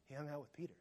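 very soft murmur; hushed gossip. Retold or imitated. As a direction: pass it on under your breath. Disciples murmuring about Peter.

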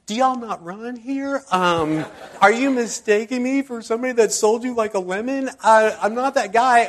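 high, loud disbelieving questions; incredulous protest. Retold or imitated. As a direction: play it baffled and offended. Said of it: "And I thought".

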